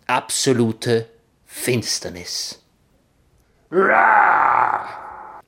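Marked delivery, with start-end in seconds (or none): 3.7-5.4 high, loud jump-scare roar; scare shout. Acted. Friends jump out to scare the narrator, loud and high. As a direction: burst out to startle someone. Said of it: "riefen Cornflakes und Jojo".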